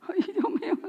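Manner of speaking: real light laugh, high and soft; laughing aside. Real, not acted. Preacher tickled by her own story, laughing. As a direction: laugh softly through the words.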